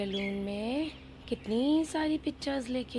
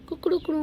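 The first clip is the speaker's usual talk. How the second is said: high playful kukdukdu crow; mock rooster call; rooster crow imitation; 7 semitones higher, 5 dB louder, much faster than usual. Teacher imitates hen sound.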